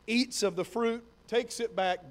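normal talking voice

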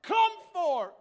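high, drawn-out bellowed summons; crying out loud. Acted. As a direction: shout all the way into the next world. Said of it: "why he had to cry so loud".

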